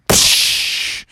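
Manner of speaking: unvoiced burst of crashing noise; mouthed whooshing crash; crash sound effect. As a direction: imitate landing in a treetop. Imitates crashing into tree.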